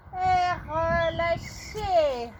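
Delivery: very slow, stretched out "relâchez"; slow drawn-out release. Real, not acted. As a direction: draw out "Et relâchez" slowly and calmly. Coach ends the set slowly.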